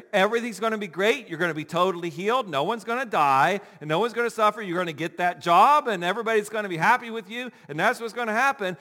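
fast, high and loud cheerful promises; rosy promises. Retold or imitated. Believer hearing only rosy promises from God. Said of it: "all we hear is".

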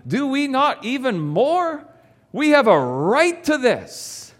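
scripture reading. Acted. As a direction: read the verses with conviction. Reads scripture aloud.

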